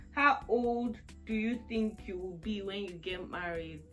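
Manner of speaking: ordinary talk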